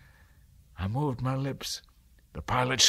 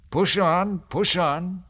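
higher, louder cheerful urging; cheerily; 8 semitones higher, 7 dB louder, slower than usual. Acted. Hermit cheerfully urging the pilot onward. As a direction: spur the rowers on brightly. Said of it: "said the hermit cheerily".

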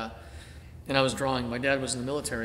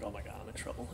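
much softer, lower inner panic; hushed worry; 4 semitones lower, 12 dB quieter, faster than usual. Retold or imitated. Boy fearing the teacher's punishment, hushed and low. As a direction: mutter it like a scared kid under his breath.

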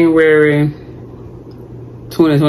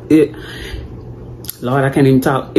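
ordinary talk, nothing marked

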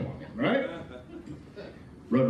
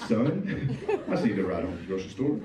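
much lower, fast mock mom voice; lightly voiced mom; 10 semitones lower than usual. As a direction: play his mother, low and quick.

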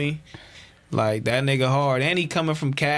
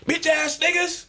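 high shouted insult; angry yell; 11 semitones higher than usual. Retold or imitated. Angry Wayne voiced in story.

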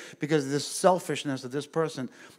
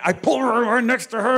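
worked up; 9 semitones higher, 8 dB louder than usual. Retold or imitated. Retelling in heat.

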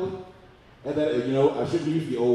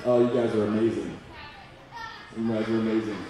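ordinary delivery

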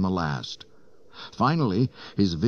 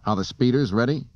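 higher, urgent demand; snapped; 6 semitones higher, 4 dB louder, faster than usual. A narrator's reading. Han demanding speeders from the deck officer, snappish. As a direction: fire off the question impatiently. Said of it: "Han snapped".

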